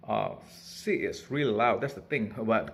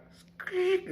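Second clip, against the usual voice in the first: very high, short squeak; mimicked parrot squeak. Owner imitates the parrot's screech with a very high squeak. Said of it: "when she start, like".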